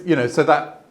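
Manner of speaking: ordinary delivery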